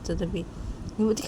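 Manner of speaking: plain reading voice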